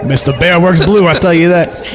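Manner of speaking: usual speaking voice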